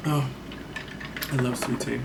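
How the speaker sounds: usual speaking voice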